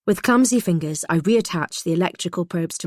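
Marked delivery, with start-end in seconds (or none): none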